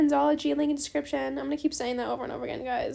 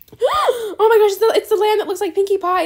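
thrilled squeal; 5 semitones higher, 10 dB louder than usual. Real, not acted. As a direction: lose it with excitement. Sees lamb she wants.

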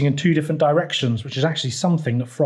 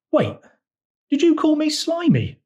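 very high, affronted frog voice; croaking Frog. A narrator's reading. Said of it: "croaked Frog".

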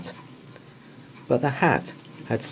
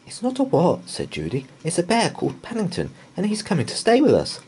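lightly voiced eager girl; quick, bright explaining; girl Judy; 9 semitones higher than usual. A narrator's reading. Judy introducing the bear to Mrs Bird, eager. Judy's voice.

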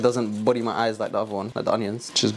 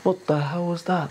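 startled; 5 semitones higher than usual. Real, not acted. Something falls with a crash.

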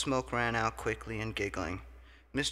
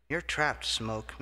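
ordinary delivery, nothing marked

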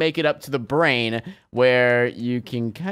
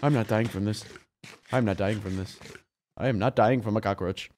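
lower, softer determined repeat; muttered; 8 semitones lower, 6 dB quieter than usual. Real, not acted. Still fighting the cockroach, quiet determined muttering. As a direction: grit it out under the breath.

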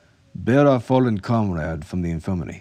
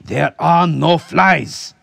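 slow, high, furious roar; commanding shout; 11 semitones higher, 11 dB louder, much slower than usual. A narrator's reading. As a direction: shout down an unruly room. Officer raps cane, silences the clamor.

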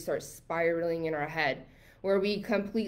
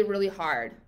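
normal talking voice

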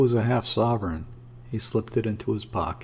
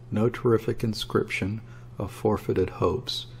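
plain reading voice

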